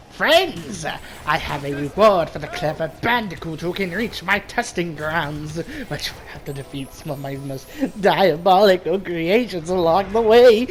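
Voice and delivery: scheming mad scientist villain voice; smug, plotting cartoon villain; Cortex impression. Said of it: "I also like doing a Cortex impression, which I did a moment ago".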